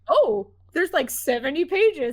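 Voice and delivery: imitated surprise. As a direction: mimic a friend taken aback. Imitates friend surprised by script length.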